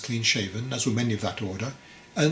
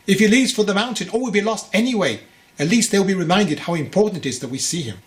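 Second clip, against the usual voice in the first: rising alarm; 7 semitones higher, 6 dB louder than usual. A narrator's reading. Father argues, voice rising.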